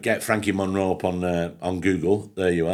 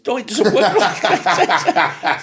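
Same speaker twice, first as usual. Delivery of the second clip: laughing retort; 11 semitones higher, 5 dB louder, much slower than usual. Retold or imitated. Arguing fee, laughing.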